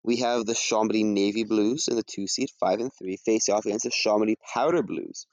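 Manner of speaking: ordinary talk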